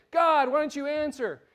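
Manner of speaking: acted exasperated prayer; frustrated plea. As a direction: demand an answer from heaven. Voicing frustrated prayer to God.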